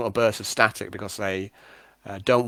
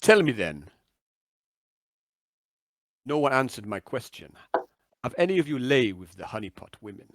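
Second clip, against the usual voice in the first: deliberate questioning with falling endings; cryptic radio contact, probing; 2 semitones higher than usual. Acted. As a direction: press the team in measured phrases. Game master voices Big Bird.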